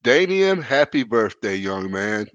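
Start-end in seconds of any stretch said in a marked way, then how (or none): none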